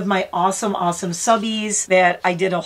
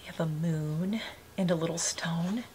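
soft secret whisper; 2 semitones lower, 11 dB quieter than usual. Real, not acted. Showing the bird the pendant, whispered. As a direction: hush it to the bird.

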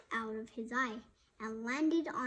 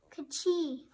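high, loud pretend sneeze; acted sneeze; 5 semitones higher, 6 dB louder than usual. A narrator's reading. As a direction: sneeze out a sharp two-beat "Ka-chee". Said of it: "Cork sneezed".

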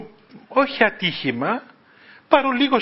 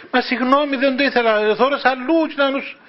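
pleading apology; 6 semitones higher than usual. Retold or imitated. Young driver pleading back.

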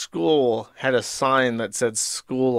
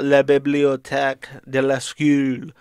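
mock French. Jokingly switches into mock French.

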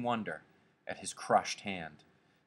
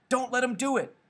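high, loud urgent yell; 5 dB louder than usual. A narrator's reading. George urges Lenny to fight back, yelling. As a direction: yell it out, pitch jumping way up.

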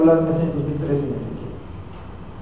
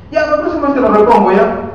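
raised-pitch, louder imitation of a paymaster; mimicked brush-off; 5 semitones higher, 5 dB louder than usual. Administrator promising the worker next month's pay, mimicked. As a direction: toss off the promise in a higher voice. Acts out administrator putting off payment.

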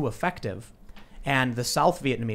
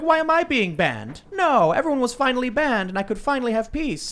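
whiny mock voice; 11 semitones higher, 4 dB louder than usual. Mocking a leftist's voice.

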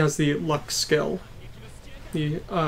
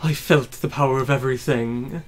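grandiose villain voice; 9 dB louder than usual. Acted. Voicing villain boss speech.

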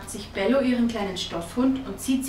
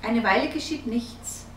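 plain reading voice

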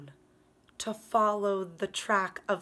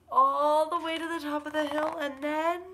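building suspense; 6 semitones higher, 6 dB louder than usual. Acted.

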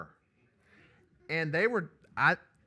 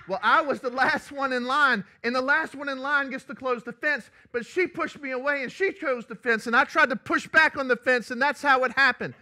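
put-on high, loud little-girl voice, laughing through it; little girl explaining; 11 semitones higher, 13 dB louder than usual. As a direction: play the small girl pleading her case. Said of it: "the sweet little one ... said".

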